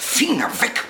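high, sharp cry to stop him; sharp warning. Acted. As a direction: protect the puppets with a sudden yelp. Snaps at him touching puppets.

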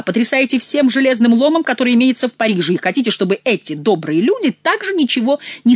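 loud, high scolding tirade. Acted. Chicot berating the king's courtiers, loud scolding. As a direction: tear into them.

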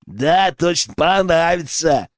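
slow, put-on henchman voice; agreeing henchman. A narrator's reading. Accomplice outside backing up the poacher, slowly agreeing. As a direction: chime in slowly, egging on the villain. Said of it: "поддакнул ему один из силуэтов".

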